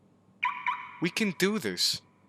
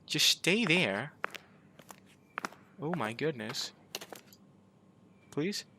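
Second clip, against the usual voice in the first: much softer, tense murmur; hushed voice; 5 dB quieter than usual. Real, not acted. Player sneaking past a monster, hushed. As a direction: keep it down so the monster won't hear.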